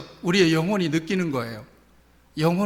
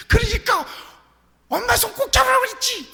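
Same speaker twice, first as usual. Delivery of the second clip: high, loud shout of a frightened mother; a mother's shrill scolding cry; 4 dB louder than usual. Retold or imitated. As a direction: yell it out in relief and anger. Mother scolding found lost child.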